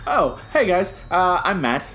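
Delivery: ordinary talk, nothing marked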